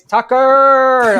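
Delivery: high, loud proud mom call; beaming proud parent. Pretend. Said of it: "in my best proud mom voice".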